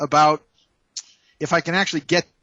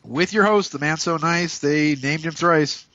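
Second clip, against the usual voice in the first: grand, higher showman voice; announcer intro; 3 semitones higher than usual. Acted. Host introduces himself as the grand showman. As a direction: hype yourself up like a game show host.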